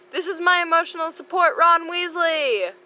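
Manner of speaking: high, fast then stretched proclamation; grand, hammed-up announcement; proud declaration. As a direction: present him like a prize. Harry's dramatic declaration.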